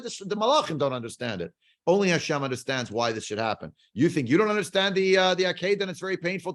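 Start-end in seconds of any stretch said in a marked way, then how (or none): none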